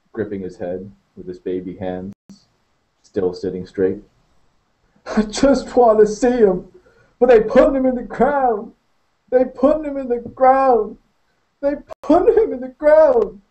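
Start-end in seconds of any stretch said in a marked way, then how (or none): 5.1-13.4 high, broken, repeated wail; crying hard. Acted. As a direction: keep sobbing the same line. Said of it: "Maurice cried harder".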